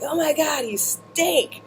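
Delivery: mildly imitating a disgusted friend. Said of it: "And she was like".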